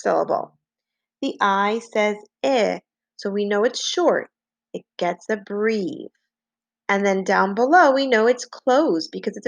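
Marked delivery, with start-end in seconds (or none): none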